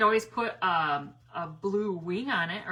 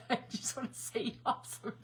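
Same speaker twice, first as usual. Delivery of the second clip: laughing; 3 semitones higher, 5 dB quieter than usual. Real, not acted. Laughing at herself.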